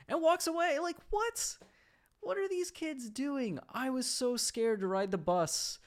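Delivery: very high incredulous voice; baffled. Real, not acted. Speaker recounting the bus kids, baffled and very high.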